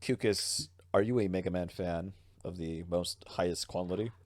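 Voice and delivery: ordinary talk, nothing marked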